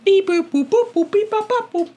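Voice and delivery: beep-boops climbing to a high squeal; robot beeping. Retold or imitated. Copying the toy robot beeps.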